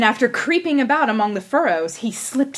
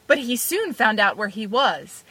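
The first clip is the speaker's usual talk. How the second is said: usual speaking voice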